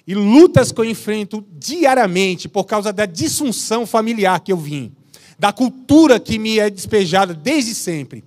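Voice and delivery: fervent pulpit declaration to the men; preaching at full force. Acted. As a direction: preach it hard, pledging before God.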